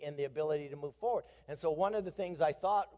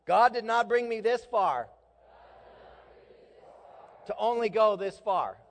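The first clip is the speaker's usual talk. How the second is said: ragged group unison; 7 semitones higher, 7 dB louder than usual. Shown as a demonstration. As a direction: say it together as one. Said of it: "Let's try that again together".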